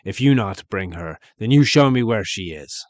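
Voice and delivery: loud booming ogre order; ogre bellow. Acted. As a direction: bellow it at the girl. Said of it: "so he bellowed".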